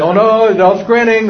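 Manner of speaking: high, loud pretend panic; hammed-up dismay; mock alarm. Voices kids alarmed by grinning adult.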